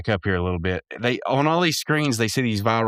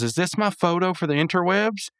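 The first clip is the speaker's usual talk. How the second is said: Pops voice; 8 semitones higher than usual. Retold or imitated. Voicing Pops.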